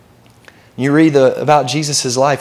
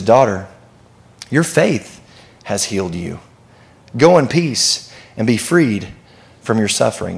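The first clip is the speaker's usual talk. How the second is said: tender; 6 semitones lower than usual. A narrator's reading. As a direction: speak it like a kind father. Jesus' real reply read tenderly.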